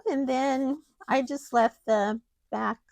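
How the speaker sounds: usual speaking voice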